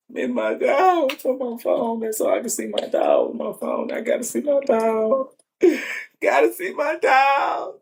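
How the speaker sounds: sudden high grief wail for a lost dog; crying out in grief. Real, not acted. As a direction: cry it out like a heartbroken owner. Owner breaks down over dead dog.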